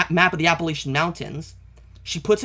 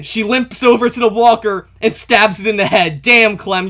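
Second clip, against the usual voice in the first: loud, higher, thrilled play-by-play; excited outburst; 4 semitones higher, 8 dB louder than usual. Real, not acted. Fan reacting to Clementine stabbing a walker, loud and excited. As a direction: burst out louder as the action hits.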